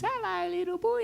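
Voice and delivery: put-on high, soft little old lady voice; sweet old lady calling hello; a lady's high, soft hello from the gate; old lady greeting. Old lady greeting third time.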